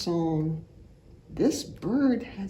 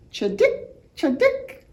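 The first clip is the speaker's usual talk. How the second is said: sparrow chirp; 7 semitones higher, 4 dB louder than usual. Acted. Reader voices sparrow jingle.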